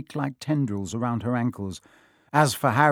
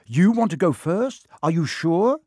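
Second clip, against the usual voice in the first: higher, rising questions; doubtful questioning; 5 semitones higher, 4 dB louder than usual. A narrator's reading. Ron asking Harry about going first, doubtful. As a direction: check with a friend, pitch climbing.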